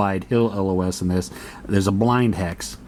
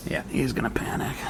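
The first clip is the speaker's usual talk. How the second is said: soft whispered aside; 8 dB quieter than usual. Acted. Solo player whispering to himself over the board. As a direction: breathe it like a secret. Repeats panic call, then whispers.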